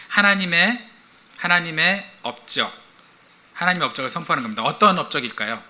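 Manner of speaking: ordinary talk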